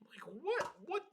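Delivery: high, rising what of disbelief; incredulous. Real, not acted. Reacting to player punching hotel worker.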